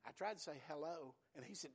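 ordinary talk

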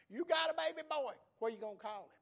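loud, high exclamation over a newborn; preacher acting out excited women; excited village women. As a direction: crow the news as delighted neighbours.

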